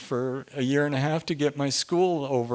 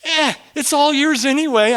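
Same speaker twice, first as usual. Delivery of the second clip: carefree giveaway; 10 semitones higher, 6 dB louder than usual. Retold or imitated. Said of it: "than just say".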